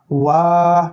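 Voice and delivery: ওয়া with a long, held "aa"; drawn-out vowel. Shown as a demonstration. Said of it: "টেনে পড়তে হবে".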